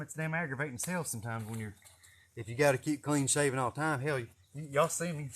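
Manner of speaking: ordinary delivery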